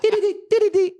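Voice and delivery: high-pitched mock error beeps; imitated keypad beeps. Storyteller mimicking the door keypad refusing the code, high beeping. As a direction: mimic an electronic reject tone, high.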